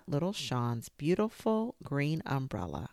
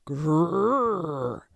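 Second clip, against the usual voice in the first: tiger growl; 3 semitones higher, 8 dB louder than usual. A narrator's reading. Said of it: "a horrible noise that sounded like".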